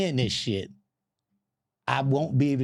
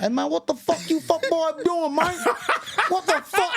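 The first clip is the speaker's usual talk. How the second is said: mock furious barber. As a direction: bark at the intruders in mock rage. Barber rushing at them angrily.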